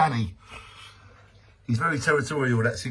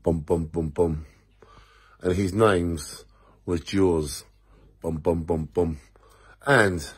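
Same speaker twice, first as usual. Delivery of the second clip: deep mock-epic fish presentation; mock dramatic announcer; 7 semitones lower than usual. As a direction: present the fish like a grand movie monster. Mock Jaws tribute introduction.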